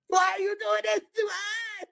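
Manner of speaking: very high, slow mock-crying squeal; hammed-up distraught wail. Girlfriend overplaying her part on the phone, mock wailing. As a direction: push the fake sob up into a squeak. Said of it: "I gotta play the distraught gir".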